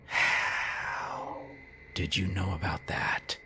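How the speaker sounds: soft, voiceless whisper; hushed whisper. A narrator's reading. Narrator whispering a question to the eerie signal, hushed. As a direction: whisper the question as if someone might overhear.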